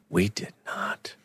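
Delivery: unvoiced stunned whisper. Real, not acted. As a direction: gasp then hush it. Gasped stunned realization.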